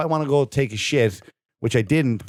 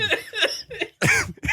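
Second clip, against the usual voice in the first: high cackle. Real, not acted. Laughing at his own story.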